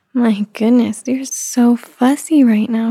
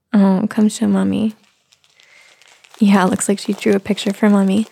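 normal talking voice

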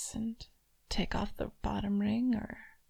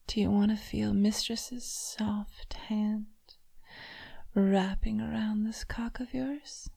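whisper. Acted. Mistress whispering to her toy, teasing. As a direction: breathe it close to the ear.

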